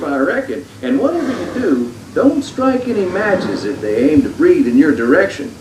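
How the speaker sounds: ordinary delivery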